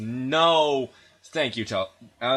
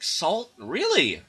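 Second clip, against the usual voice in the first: higher, startled "Salt?"; mock disbelief; 9 semitones higher, 5 dB louder, much slower than usual. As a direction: act politely baffled. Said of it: "It's like".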